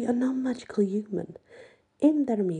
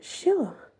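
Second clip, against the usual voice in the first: plain reading voice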